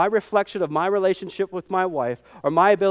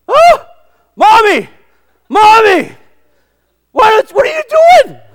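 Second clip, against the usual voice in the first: high, loud shrieking eaglet cries; panicked baby eagle; 16 dB louder, slower than usual. Retold or imitated. Preacher voicing a baby eagle dropped by its mother, shrieking. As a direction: scream as if falling from the sky.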